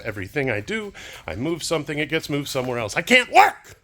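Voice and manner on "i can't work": high, loud cry of real frustration; frustrated outburst. Real, not acted. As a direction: burst out, fed up. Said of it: "I don't mean to get so frustrated".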